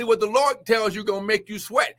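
usual speaking voice

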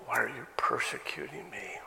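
whispered, breathy question, low and soft; hushed quote. Acted. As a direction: whisper it like a voice from nowhere. God's voice quoted to Saul.

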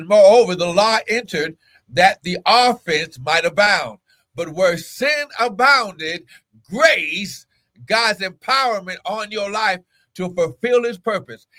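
high-pitched, emphatic scripture proclamation; preaching. Real, not acted. Preaches from a Bible verse.

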